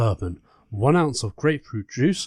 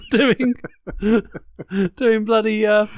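laughing; 9 semitones higher, 4 dB louder than usual. Real, not acted. Still laughing at image.

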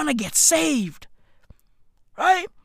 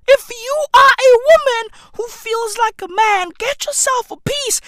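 mock advice voice; 6 semitones higher than usual. Mock advice, high pitched.

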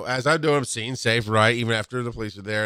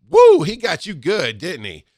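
blurted tactless quip; 6 semitones higher, 8 dB louder than usual. Retold or imitated. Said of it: "failure to engage the brain".

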